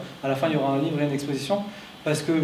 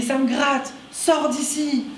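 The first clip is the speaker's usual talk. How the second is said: much higher, mock yelled refusal; imitated shout; 10 semitones higher, much slower than usual. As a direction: yell it back, high and strained. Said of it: "il se met à me hurler".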